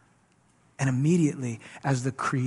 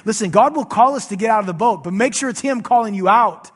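usual speaking voice

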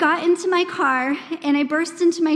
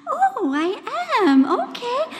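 calm surprise; 9 semitones higher, slower than usual. Retold or imitated. Imitating the calm woman on video.

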